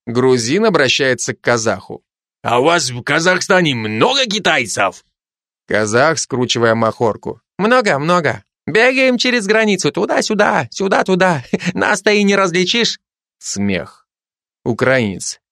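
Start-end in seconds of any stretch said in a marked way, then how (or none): 2.4-5.1 put-on lightly Georgian-accented sly question. Georgian soldier quizzing the Kazakh, in a Georgian accent. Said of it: "каждый со своим акцентом". 7.6-13.0 put-on higher joking Kazakh-accented voice, a laugh near the end. Kazakh soldier joking about the border, in a Kazakh accent. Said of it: "каждый со своим акцентом".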